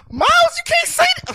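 high wailing imitation of her crying; mock crying girlfriend. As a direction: squeak it out like a weepy girlfriend, hammed up. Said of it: "Now she started crying".

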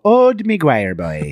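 slow, high-starting mock Irish lilt; Irish accent. Teasing a kid on the floor, mock Irish accent. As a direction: call it out in cartoon Irish. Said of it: "which is an Irish name".

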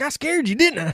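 high, loud mock kid voice; gleeful little boy. Son gloating to his parents after hiding, gleeful and high.

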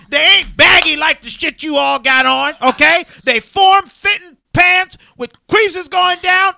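principal gushing. Retold or imitated. Principal praising the boy's pleated pants to the crowd, loud and high. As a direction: show off his pants to everyone.

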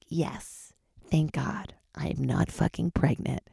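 slow whispered relief; hushed slow whisper of relief; relieved whisper. Retold or imitated. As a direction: breathe it out like a secret. Relief voiced as a secret.

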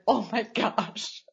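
slow, drawn-out, melted-heart oh my gosh; melting aww. Retold or imitated. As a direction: let it melt out slowly, touched. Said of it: "I'm like".

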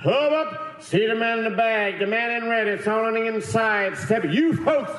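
high-pitched carnival barker's holler. Retold or imitated. Elf calling shoppers in to see Santa, carnival barker pitch. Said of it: "like, a carnival Barker".

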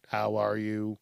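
low, soft robotic monotone; measured, droning assistant greeting; standard assistant voice. Acted. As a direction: recite it like a stock voice assistant. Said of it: "the standard".

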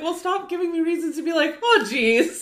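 high-pitched playful complaint; mock exasperation. Telling Jeff to stop giving reasons, mock exasperated. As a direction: protest in a squeaky fuss.

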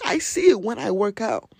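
normal talking voice

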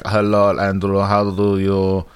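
put-on a clumsy Russian-accented Putin; Vladimir Putin impression. Playing Putin asking Andrew about a jet ski stereo, in a bad Russian accent. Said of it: "your Vladimir Putin impersonation".

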